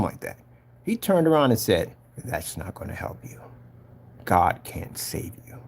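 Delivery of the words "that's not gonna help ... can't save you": low, hushed, slow possessed voice; quiet, low, sinister pronouncement; strange demonic voice. Retold or imitated. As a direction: imitate a demon speaking softly and slowly through a man. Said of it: "in this really strange voice".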